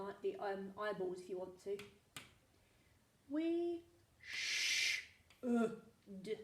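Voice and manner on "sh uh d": "sh-, uh, d-," split into separate sounds; long "sh-", then short separate "uh" and "d-"; sounding out. Shown as a demonstration. Teacher writing for pupils, sounding out "sh-, uh, d-," one by one.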